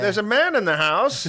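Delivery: hammed-up panic at the intruder; mock alarm. Wife alerting husband to the intruder in mock alarm.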